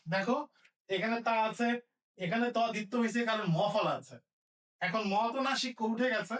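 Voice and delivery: normal talking voice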